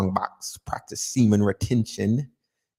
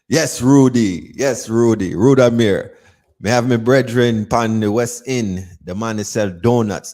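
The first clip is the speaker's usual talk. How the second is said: loud mock Jamaican shoutout; 2 semitones higher, 6 dB louder than usual.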